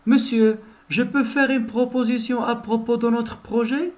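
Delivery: student repeating the polite question. Shown as a demonstration. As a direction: ask the teacher permission nicely. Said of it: "je répète".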